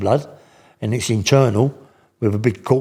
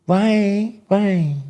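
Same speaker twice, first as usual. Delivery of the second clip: much higher calling voice; Billy McGee's voice; 9 semitones higher than usual. Retold or imitated. Inmate calling through the cell window, much higher voice. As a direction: pitch it up high and call his name. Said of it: "this is how it talks".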